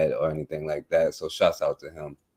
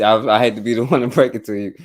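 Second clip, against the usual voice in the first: laughing through the bad news, quick and louder; 4 semitones higher, 6 dB louder, faster than usual. Real, not acted. Host laughing as he breaks doom to listeners. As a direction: chuckle it out while apologizing.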